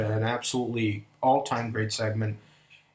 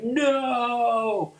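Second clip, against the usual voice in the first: retold scream; 10 semitones higher than usual. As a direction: scream the no up high as the valet. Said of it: "screams the biggest no you ever heard".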